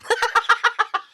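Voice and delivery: a short high cackle; high laugh. Real, not acted. Host laughing at the wee-guy joke, high cackle. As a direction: crack up, high and loud.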